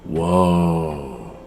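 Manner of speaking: put-on a drawn-out, falling, childlike "Whoa"; a light child impression, awed and falling; imitating a toddler's awe. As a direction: voice the niece's wonder at the ship. And she goes.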